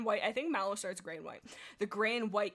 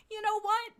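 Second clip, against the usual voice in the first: high cat voice; 12 semitones higher, 7 dB louder than usual. Acted.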